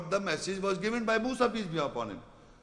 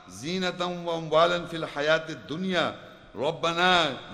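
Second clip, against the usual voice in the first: louder, fast fervent chant; Quran recitation; 4 dB louder, much faster than usual. Acted. Preacher reciting Moses' prayer against Pharaoh, fervent chant.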